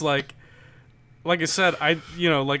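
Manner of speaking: usual speaking voice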